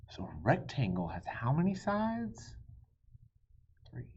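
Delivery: usual speaking voice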